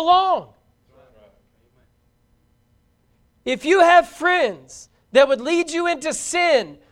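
loud, high, emphatic sermon; full-force preaching. Real, not acted. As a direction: drive each phrase home to the congregation.

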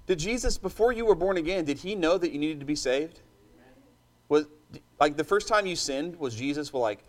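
usual speaking voice

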